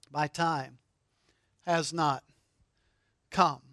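hushed solemn. Acted. Quiet solemn restatement.

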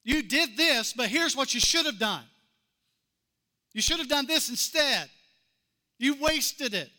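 pointed, scolding critic. Retold or imitated. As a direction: lecture her on what she should have done. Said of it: "Expectations issue".

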